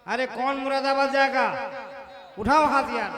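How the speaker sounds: loud call to the crowd. Real, not acted. Host calling out to the crowd, loud. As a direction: call out to the whole hall.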